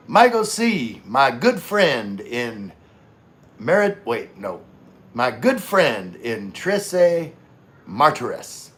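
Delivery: loud, slow, drawn-out hype greeting; Gino impression. Streamer doing his friend Gino's big hyped greeting. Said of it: "I'm gonna do my best Gino impression".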